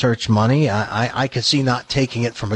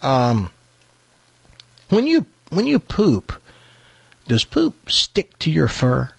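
bear voice; 4 semitones higher than usual. Retold or imitated. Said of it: "he says".

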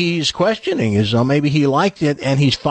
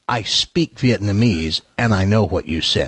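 slow, lower, deliberate phrase; 6 semitones lower, slower than usual. Pretend. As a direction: spell it out slowly for effect. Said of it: "Learn how to say".

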